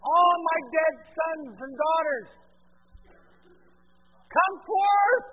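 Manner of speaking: a mighty loud summons; commanding cry. Acted. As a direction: call the dead up out of their graves. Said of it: "what is he going to cry out".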